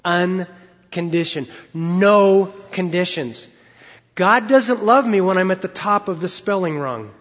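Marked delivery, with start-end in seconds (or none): 0.0-3.5 Unconditioned slowed and leaned on, each syllable weighted; emphasized word. Shown as a demonstration. Preacher stresses Unconditioned for the congregation, slow and emphatic. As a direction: stretch out Unconditioned and No conditions, slowly and firmly. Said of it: "I want to emphasize that word again".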